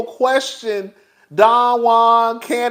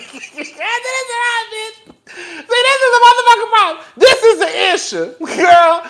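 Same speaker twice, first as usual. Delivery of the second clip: higher, laughing through the words; laughing mock outrage; 8 semitones higher than usual. Real, not acted. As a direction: cackle at the absurdity.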